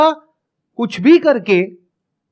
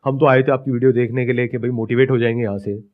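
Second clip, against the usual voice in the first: much lower, hushed commenter voice; imitating protesting viewers; 10 semitones lower, 3 dB quieter than usual. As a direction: play a disappointed viewer in a low, subdued mumble. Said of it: "बहुत सारे लोग कहेंगे".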